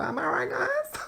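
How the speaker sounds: very high, slow squeak; squeaky voice. Pretend. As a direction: squeak it playfully. Playful question to viewers.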